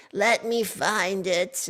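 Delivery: put-on high, slow old lady voice; grandma voice. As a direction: take your time like a frail grandma. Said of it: "I do my grandma's voice".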